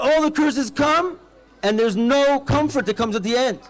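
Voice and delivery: usual speaking voice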